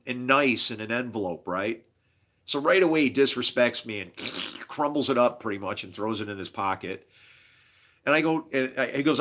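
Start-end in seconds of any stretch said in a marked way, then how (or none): none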